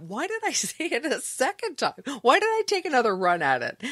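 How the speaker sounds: high, exasperated question to yourself; incredulous self-questioning. Retold or imitated. Newscaster asking self about the repeated slip, incredulous. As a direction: laugh a little as you berate yourself.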